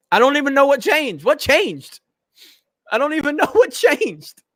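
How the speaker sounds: mock confusion with a laugh creeping in; baffled reaction. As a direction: play an utterly confused listener, amused. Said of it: "And you're like".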